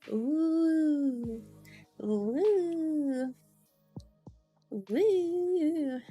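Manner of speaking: hammed-up fortune-teller "ooh", held and gliding; spooky oohs. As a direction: coo it long and high, playfully mystical. Mock mystical oohs during tarot.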